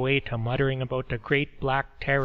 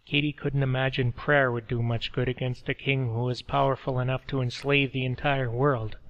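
usual speaking voice

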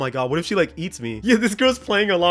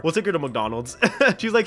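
usual speaking voice

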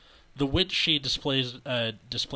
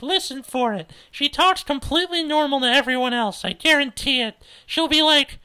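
high, loud argument; loud, high-voiced insistence; insistent teen; 11 dB louder than usual. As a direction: press your point, pitched way up. Cordelia insists to Anne.

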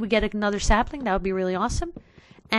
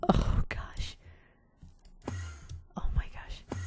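breathless stunned whisper; 9 dB quieter, slower than usual. Real, not acted. Gamer staring at the hole, stunned whisper. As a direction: whisper it in disbelief.

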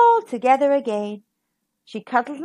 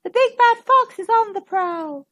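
higher, urgent owl voice; Owl warning; 8 semitones higher, 4 dB louder than usual. A narrator's reading. Owl warns Hen about the fox, higher urgent voice. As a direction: keep the owl's high alarm going. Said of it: "cried Owl".

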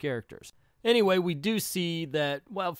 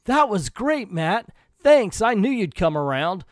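higher, eager little thank-you voice; grateful Ferengi; 6 semitones higher, 7 dB louder than usual. Acted. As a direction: gush your thanks up high.

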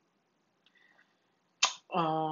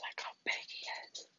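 soft whisper; 8 dB quieter than usual. Real, not acted. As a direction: breathe it out. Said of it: "so I do not wanna wake him".

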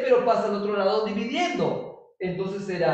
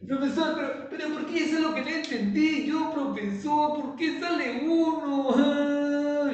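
higher, pleading student who didn't get it; whiny student voice; 6 semitones higher than usual. Acted. Teacher acting a confused student pleading with the professor, higher whiny voice. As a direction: play the lost pupil begging for an explanation.

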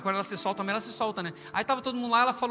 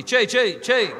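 loud repeated preacher calls; mock wake-up command; 3 semitones higher, 7 dB louder, much slower than usual. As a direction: imitate a pastor reviving someone. Pastor's wake-up calls used on wife. (brados fortes para acordar)

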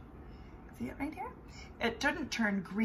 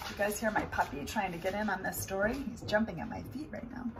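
usual speaking voice

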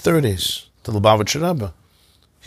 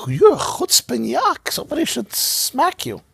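high, slow, outraged mock yell; shocked high-pitched retort, half joking; indignant outburst; 13 semitones higher, slower than usual. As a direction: blurt it out like you're scandalised. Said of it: "I'm like".

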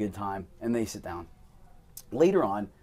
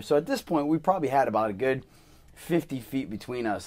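ordinary talk

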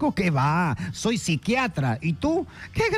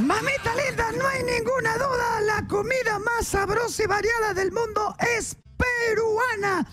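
much higher, hammy announcer sell of the daily paper; hyped radio ad read. Acted. Host plugging the newspaper to listeners, in a booming hyped ad voice. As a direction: pitch the paper like a carnival barker.

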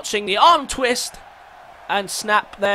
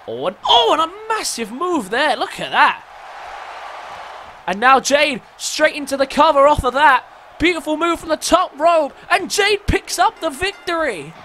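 high, ringing call of the win; excited commentary; 4 semitones higher than usual. Real, not acted. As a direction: sell the big finish to the crowd. Match-winning move and victory call.

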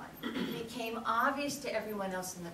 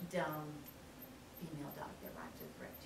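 low, fast, bitter mutter; muttered under breath; 6 semitones lower, 10 dB quieter than usual. Retold or imitated. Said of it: "mutters under his breath".

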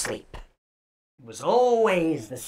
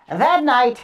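ordinary delivery, nothing marked

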